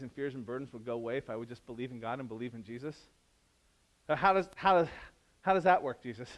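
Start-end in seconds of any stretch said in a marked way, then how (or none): 4.1-6.3 skeptical asking. Retold or imitated. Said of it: "doubter's question".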